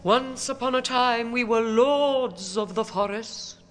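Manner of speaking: a doleful Transylvanian count; vampire count voice. Acted.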